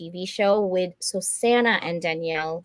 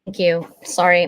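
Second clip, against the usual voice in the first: ordinary talk, nothing marked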